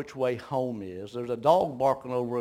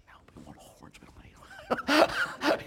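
whispered, much softer correction; hushed reply; 13 semitones higher, 3 dB quieter than usual. Retold or imitated. Father hushing his son in the cornfield, firm whisper. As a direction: whisper back firmly so the deer won't hear.

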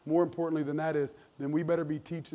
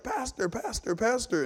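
put-on high little-kid voice; imitated child, pitched high; child calling out; 6 semitones higher than usual. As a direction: call out eagerly like a small child.

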